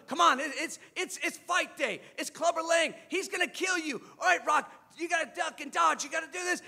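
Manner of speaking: high-pitched, pressing sparring pep talk; urgent coaching. Retold or imitated. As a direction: urge your fighter on. Apollo urging Rocky while sparring.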